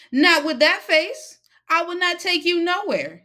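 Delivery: high, loud scolding woman. Acted.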